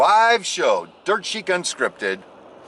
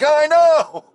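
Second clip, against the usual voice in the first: high, loud mock wail; exclaiming agreement; 12 semitones higher, 6 dB louder than usual. Man to his wife about the broken toe, exclaiming. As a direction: squeal it in exaggerated agreement. Commiserating with wife's shocked reaction.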